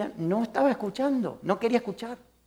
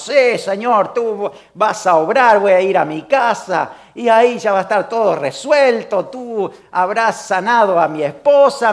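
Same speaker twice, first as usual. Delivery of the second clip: overconfident prayer; 6 dB louder than usual. Retold or imitated. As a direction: pray as if it is already done. Imitating confident group prayer.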